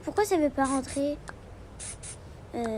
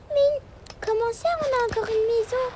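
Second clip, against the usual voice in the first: put-on surprised little girl; 11 semitones higher than usual. Daughter surprised.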